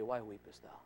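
hushed, low and tender; gentle low murmur of comfort; soothing divine voice. Acted. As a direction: speak as the Lord, very softly. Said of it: "Listen for the Lord to say".

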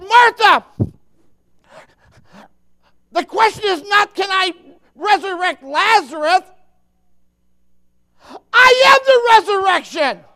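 high, shouted declaration of power; thundering, stern preacher voice. Acted. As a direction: shout it at full force as a stern Jesus. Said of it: "I think he said it sternly".

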